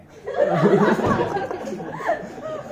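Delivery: high burst of laughter; loud laugh. Real, not acted. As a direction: break into laughter. Laughs after the quote.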